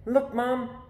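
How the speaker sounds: put-on a boy calling upstairs to Mom. A narrator's reading. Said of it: "I call".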